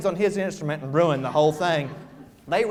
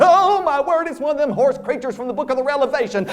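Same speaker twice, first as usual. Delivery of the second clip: high, loud startled shriek of alarm; frightened cry; 7 semitones higher, 6 dB louder than usual. Acted. Preacher crying out in fright at the half-mule creature. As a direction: gasp it out in holy terror.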